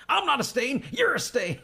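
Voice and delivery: high-pitched, hammed-up crazy retort; Manson. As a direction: throw it back in a high mock-manic voice. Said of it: "And then Ask Manson".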